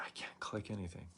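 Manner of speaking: whispered complaint. Retold or imitated. Telling the phone agent in a whisper. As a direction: drop to a whisper, exasperated. Frustrated customer on support call.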